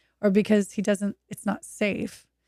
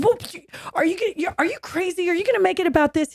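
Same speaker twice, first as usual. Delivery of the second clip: high, indignant mock outburst; a surgeon huffing in mock outrage; mock angry surgeon; 9 semitones higher, faster than usual. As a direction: play the offended doctor blowing up. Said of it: "He's like".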